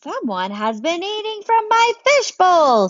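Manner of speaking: outraged daddy shouting. Acted. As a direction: yell it like an outraged dad. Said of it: "a voice shouting very, very loudly".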